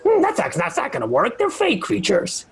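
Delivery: amused NPC doubting it will work; [name]'s voice. Acted. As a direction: play the NPC unconvinced, chuckling.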